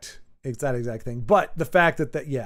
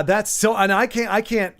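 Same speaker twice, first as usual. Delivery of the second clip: usual speaking voice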